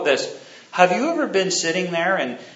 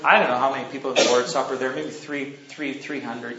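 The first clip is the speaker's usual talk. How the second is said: ordinary delivery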